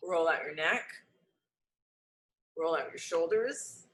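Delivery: low, unhurried meditation voice with long pauses; guided meditation. Acted. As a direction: guide the room gently into the body. Leads a breathing meditation.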